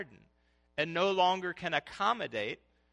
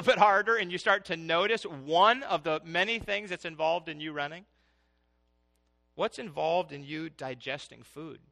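ordinary talk, nothing marked

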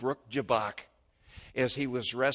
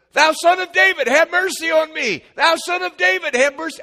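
high, loud pleading cry; desperate cry; 16 dB louder than usual. Retold or imitated. Blind Bartimaeus crying to Jesus over the crowd, high and loud. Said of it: "the more he said".

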